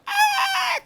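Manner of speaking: extremely high, loud panicked shriek; mock scream. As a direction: scream as someone lands on you. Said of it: "he started screaming like".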